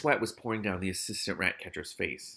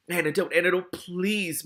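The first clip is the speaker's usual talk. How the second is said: higher-pitched mild moan; 7 semitones higher, 4 dB louder than usual. A narrator's reading. Poisoned Ratcatcher Two begging for antidote, moaning. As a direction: beg for it, moaning. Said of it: "moaned Ratcatcher Two".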